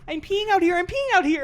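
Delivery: put-on very high, loud little-kid wail; toddler voice. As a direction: shout it like a panicked toddler. Mocking friend as toddler.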